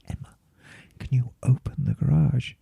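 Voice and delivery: soft secretive whisper. Retold or imitated. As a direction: whisper it like a sneaky request. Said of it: "surreptitiously comes up to me and says".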